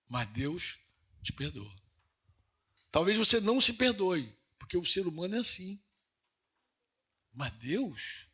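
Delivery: ordinary talk, nothing marked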